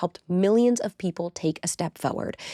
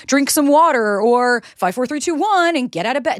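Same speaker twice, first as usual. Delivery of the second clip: mocking advice; 6 semitones higher, 6 dB louder than usual. Mocking generic self-help advice.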